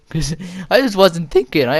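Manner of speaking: normal talking voice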